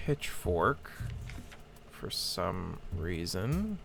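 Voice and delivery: normal talking voice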